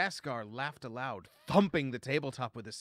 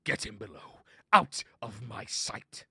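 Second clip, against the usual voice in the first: furious pirate captain's snarl; snarled order; 8 dB louder than usual. A narrator's reading. Enraged Daskar banishes the slave below deck, snarling. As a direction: snarl it, leaning on each phrase. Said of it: "he snarled".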